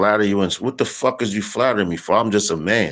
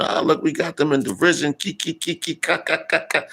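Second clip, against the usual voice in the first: high taunting cackle, ki ki ka ka; high jeering cackle with nasty glee; mock mocking laughter; 9 semitones higher than usual. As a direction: cackle like scornful nations gloating. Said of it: "They're laughing, joking".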